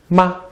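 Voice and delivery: "ma" short, high and light; a quick toneless "ma"; neutral tone. Shown as a demonstration. Teacher adding a fifth option, short neutral-tone "ma". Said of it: "now we have the neutral tone".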